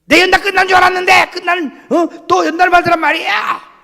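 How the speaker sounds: high, loud furious yelling; screaming woman. Retold or imitated. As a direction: scream it in outrage. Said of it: "소리를 지르고 악을 쓰는 거야".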